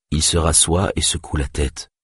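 ordinary delivery, nothing marked

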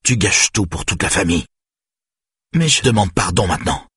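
forceful accusation, rising in pitch; angry scolding. A narrator's reading. Father trembling with rage at his daughter, angry. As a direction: lash out and demand an apology. Enraged father demanding apology.

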